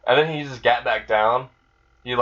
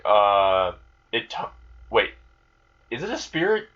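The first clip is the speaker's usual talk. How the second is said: surprised. Retold or imitated. Amelia surprised by talking creature.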